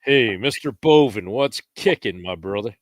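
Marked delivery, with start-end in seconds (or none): none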